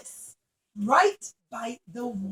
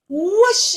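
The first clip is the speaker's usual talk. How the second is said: wave whoosh; 5 semitones higher, 8 dB louder than usual. A narrator's reading. Sound of wave.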